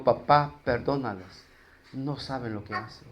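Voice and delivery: súplica suave y baja, casi susurrada; oración en voz baja. Acted. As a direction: pide perdón con ternura, sin fuerza. Cita la oración de Jesús. (Jesús en la cruz, rezando suave al Padre)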